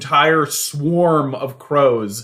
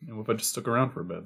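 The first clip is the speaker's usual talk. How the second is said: much softer seagull mooch; 4 dB quieter, much faster than usual. Acted. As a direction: wheedle for food quietly as a seagull. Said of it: "the seagull looks on to her and says".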